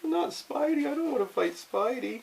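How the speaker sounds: high pleading refusal; scared. Acted. Villain refuses to fight Spidey, high scared plea. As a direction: beg off in a high frightened voice. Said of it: "They're all scared".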